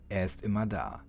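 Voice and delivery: ordinary delivery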